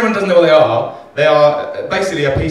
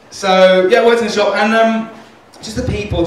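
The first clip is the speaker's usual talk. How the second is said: ordinary talk, nothing marked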